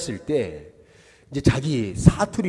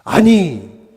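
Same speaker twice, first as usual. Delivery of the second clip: loud, higher-pitched protest; retold shouting; 4 semitones higher, 8 dB louder, much slower than usual. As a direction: imitate the apostles crying out to stop it. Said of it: "소리 질러서 얘기합니다".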